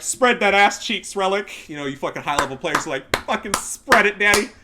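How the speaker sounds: loud, high hammed-up crude order; crude mock demand. Mocking high-level players bullying the devs, loud crude parody.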